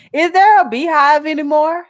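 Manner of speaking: laughing through the question, pitch up. Real, not acted. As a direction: giggle out the question in disbelief. Laughing reading a comment.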